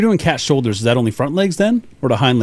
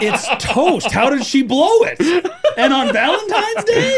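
high, slow mock indignation; mock outrage; 13 semitones higher, slower than usual. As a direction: play it up, scandalized. Mock outrage at vintage card.

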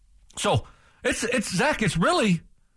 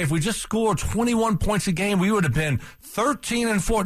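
mock naive fan; 6 semitones higher than usual. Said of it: "It's like, okay".

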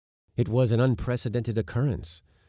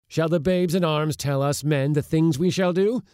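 raised, indignant voice of an elder; angry elder scolding a boy loudly; loud demand; 9 semitones higher, 6 dB louder than usual. A narrator's reading. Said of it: "demanded in a loud voice".

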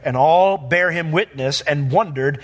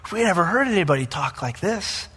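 amazed crowd; 2 semitones higher than usual. Retold or imitated. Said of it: "why they would go".

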